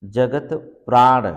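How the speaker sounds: जगत प्राण said slowly and loud, each syllable clear; slow, loud teacher's model of जगत प्राण; slow emphatic correct form. Shown as a demonstration. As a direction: spell it out slowly, जगत प्राण with a firm halant. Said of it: "हलंत रहना चाहिए".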